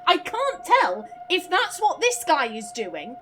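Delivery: ordinary talk, nothing marked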